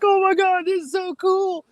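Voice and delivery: very high, squealing fan imitation; mock fangirl squeal; swarming fans. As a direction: mimic giddy fans, stretching the end. Imitates young fans swarming him.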